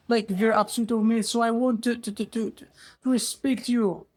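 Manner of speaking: high-pitched, earnest excuse; acted humble subject defending himself; pleading explanation. Ghirab explains himself to king.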